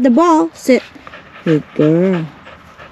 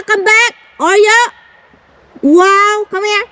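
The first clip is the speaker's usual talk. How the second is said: calling a dog; 11 semitones higher, slower than usual. Real, not acted.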